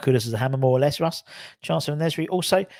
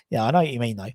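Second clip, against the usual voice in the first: ordinary talk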